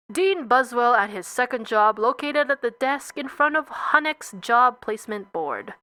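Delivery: plain reading voice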